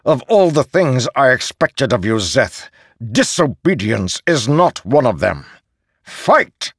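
seething anger building to roar; furious bellow. Acted. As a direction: bellow the command at Szeth. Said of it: "with a furious tone bellowed".

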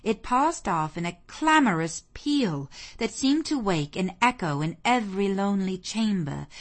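usual speaking voice

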